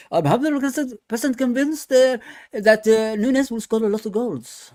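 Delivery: put-on a light Arabic accent. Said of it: "with his Arabic accent".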